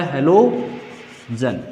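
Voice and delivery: low, slow, word split in two; slow teaching repeat. Real, not acted. Teacher answering own question for students, slow and low. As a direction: draw out halogen in separated parts.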